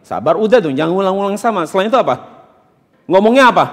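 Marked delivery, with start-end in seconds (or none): none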